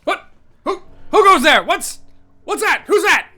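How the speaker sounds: loud, high alarmed calls; calling out. Pretend. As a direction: shout into the dark. Calling out to hidden voice.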